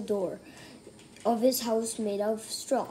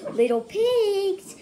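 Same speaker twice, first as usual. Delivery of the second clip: higher, slow, sugary call; sweetly; 8 semitones higher, 5 dB louder, slower than usual. Acted. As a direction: coax the pigs sweetly.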